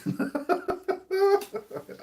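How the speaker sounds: high, delighted laughing burst; high burst of laughter. Real, not acted. Husband laughing while retelling wife's question, high laughter. Laughing at his own story.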